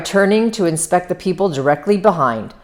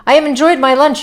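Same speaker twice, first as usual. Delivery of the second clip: departing lunch guest; 11 semitones higher, 4 dB louder, faster than usual. A narrator's reading. Wolfsheim taking his leave, acted.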